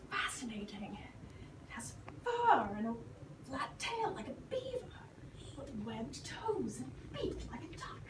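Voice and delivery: hushed whisper, unvoiced. George observing the platypus, whispering. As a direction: whisper so you don't scare it.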